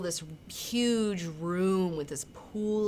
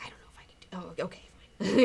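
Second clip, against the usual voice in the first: half-whispered, grudging give-in; hushed reluctance; faster than usual. Retold or imitated. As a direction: mutter a reluctant agreement under your breath. Retold reluctant agreement by Night Owl.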